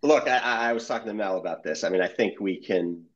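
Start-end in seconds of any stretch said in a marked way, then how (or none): 0.0-0.3 high burst of laughter into but look; laughing hard while trying to go on. Real, not acted. Editor laughing at his own joke about trouble.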